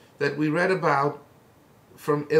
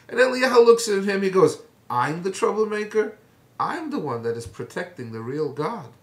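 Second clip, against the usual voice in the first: usual speaking voice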